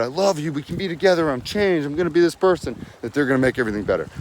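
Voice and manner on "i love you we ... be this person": high mock pleading; lightly voiced, hopeful plea to an ex; begging the ex. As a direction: play the desperate ex at the door. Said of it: "showed up to their house and said".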